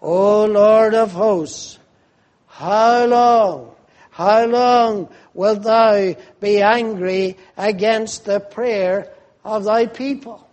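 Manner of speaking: loud, slow, pleading recitation; fervent psalm cry. Acted. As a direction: cry the verse out to God. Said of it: "verse four".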